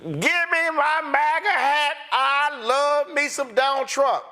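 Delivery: mock farmer's boast. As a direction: sneer it out like a smug MAGA fan.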